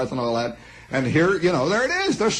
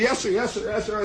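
put-on higher, eager kid answering the boss; a hint of a nervous young clerk; eager yes sir; 6 semitones higher than usual. Kid answering the cocky driver, lightly voiced eager yes sir. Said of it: "So I say".

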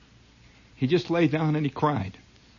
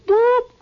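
whistle boop; 7 dB louder than usual. Retold or imitated. Imitated whistle.

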